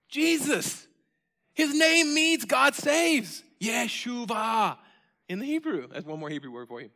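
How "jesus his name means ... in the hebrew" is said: high, loud, slow proclaiming shout; preacher's ringing shout; shouted proclamation. Real, not acted. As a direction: shout the name to the rafters.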